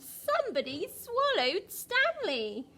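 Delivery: suspenseful storybook refrain; dramatic reveal. A narrator's reading. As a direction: make the children gasp at swallowed.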